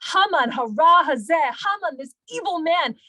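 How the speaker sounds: high, forceful denunciation, loud at the start; dramatic accusation. Retold or imitated. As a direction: denounce the villain before the king. Esther's acted accusation of Haman.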